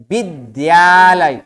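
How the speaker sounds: विद्यालय said slowly with half द plus य, not ध्य; careful pronunciation. Shown as a demonstration. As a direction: spell out विद्यालय slowly as bi-dya-lay. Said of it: "द्य का उच्चारण करना है". (विद्यालय को धीरे, आधा द और पूरा य से बोलें)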